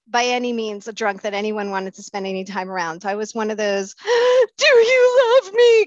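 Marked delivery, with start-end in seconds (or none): 4.0-4.5 gasp. Retold or imitated. Drunk crying plea. 4.6-5.9 high, slow, teary plea; crying drunk. Retold or imitated. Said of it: "crying drunks".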